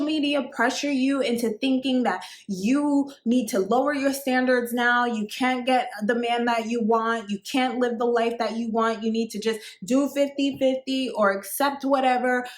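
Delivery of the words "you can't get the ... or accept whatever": ordinary talk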